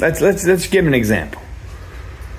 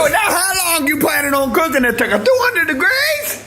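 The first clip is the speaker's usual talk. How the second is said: mock outraged. Brother yelling at Andrew about his turkey, mock outrage. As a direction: shout at him in fake horror.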